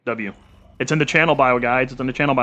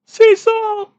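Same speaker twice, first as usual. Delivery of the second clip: squeak; 8 dB louder than usual. Real, not acted. Squeaky outburst while scrolling.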